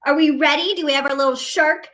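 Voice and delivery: ordinary delivery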